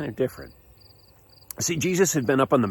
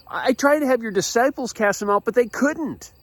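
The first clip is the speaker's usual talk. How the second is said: high-pitched, distressed father; acted-out frantic pleading; desperate complaint; 11 semitones higher than usual. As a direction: plead that all help has failed. Frantic father keeps pleading with Jesus.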